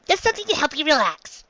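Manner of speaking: high little Chopper. Acted. Chopper answering Sanji about Luffy, high squeaky voice. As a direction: squeak it like a tiny reindeer.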